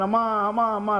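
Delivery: acted urgent plea, repeated without a break; lightly pleading child's call; mildly anguished cry. Shown as a demonstration. Child who cannot live without mother, mock anguished calling. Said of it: "Aarti కలగాలి".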